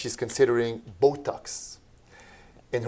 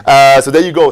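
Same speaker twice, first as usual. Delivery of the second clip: excited; 4 semitones higher, 11 dB louder than usual. Real, not acted. Host marvels at treatment news.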